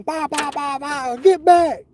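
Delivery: slow mock gunshots then a yelled get back; playful gunfire noises; imitated gunfire, slow. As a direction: bah bah bah like a gun firing, drawn out. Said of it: "Shooting at 'em".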